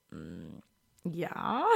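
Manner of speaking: very quiet, low and reluctant; acted sheepish yes; hesitant admission. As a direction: admit it warily, under your voice. Acts reluctant admission about podcast.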